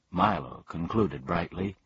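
plain reading voice

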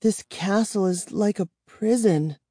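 trembling whisper of fear; shaky whisper. Acted. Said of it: "Kara said in a shaky whisper".

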